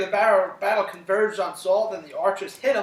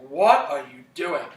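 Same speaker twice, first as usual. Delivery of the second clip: a mock-furious, louder outburst from the dead; angry Samuel; 2 semitones lower, 6 dB louder than usual. Teacher voicing an angry Samuel for the class, mock-furious. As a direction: snap it like an angry ghost woken up. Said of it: "he is so mad".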